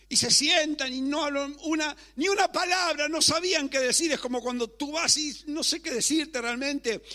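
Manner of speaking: high, loud, impassioned sermon delivery; full-force preaching. Real, not acted. Preacher recounting how Job lost everything, full-force preaching. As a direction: preach it out, driving each phrase upward.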